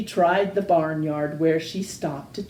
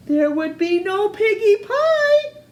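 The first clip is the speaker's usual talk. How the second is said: wail; 13 semitones higher, 4 dB louder than usual. Acted. Witch wailing over lost pie.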